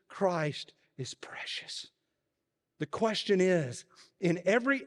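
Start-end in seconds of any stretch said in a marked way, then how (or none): 0.1-1.9 whisper. A narrator's reading. Quiet retelling of the whisper.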